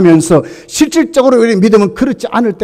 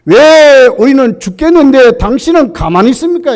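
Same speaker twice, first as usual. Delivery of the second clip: complaining; 5 semitones higher, 6 dB louder than usual. Retold or imitated. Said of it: "원망 섞인 것으로".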